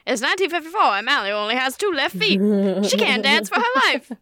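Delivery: put-on high, bright old-timey announcer; narrator voice. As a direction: newsreel narration voice. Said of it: "narrate my daily living with that voice".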